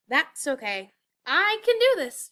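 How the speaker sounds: rising to a loud, high determined cry; exclaimed. A narrator's reading. Elf cheering herself on to finish alone, rising loud. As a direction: psych yourself up, ending high. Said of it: "she exclaimed".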